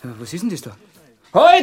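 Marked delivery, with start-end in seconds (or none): 1.3-1.6 high, very loud sudden stop; sudden shout. Acted. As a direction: cry out halt at once. Inspektor entdeckt etwas. (plötzlicher Ruf)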